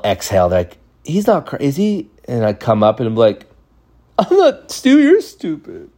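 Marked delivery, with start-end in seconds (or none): 1.0-2.1 mocking classmates. Classmates asking if he cries. 4.2-5.9 choked high whine trying not to cry; a kid holding back tears, voice breaking up high; voice cracking from tears. Retold or imitated. Boy on the bus insisting he is fine while nearly crying.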